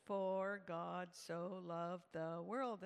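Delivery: imitated father reciting very slowly; deliberate recitation. Father corrects his daughter's verse, deliberate. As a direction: spell out the verse word by word, slowly.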